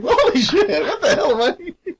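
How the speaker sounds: high squeal through laughter; laughing in disbelief. Real, not acted. Laughing at own words.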